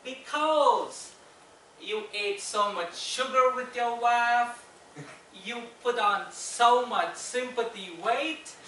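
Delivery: high, slow southern drawl; loud, drawn-out southern nurse delivering a punchline; southern accent. Acted. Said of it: "That was my southern accent".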